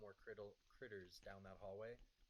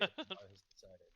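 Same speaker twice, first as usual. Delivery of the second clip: loud, slow bardic declaration; cheeky bard voice; 13 dB louder, slower than usual. Acted. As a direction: proclaim it grandly, like a stage bard. Bard line continues, loud slow.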